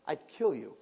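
usual speaking voice

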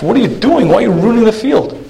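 a shocked onlooker's protest; aghast. Retold or imitated. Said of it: "looks at the farmer aghast".

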